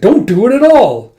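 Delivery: high, loud order; high, loud telling-off; sharp scolding. Acted. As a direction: forbid it flatly and loudly. Character sharply forbids her.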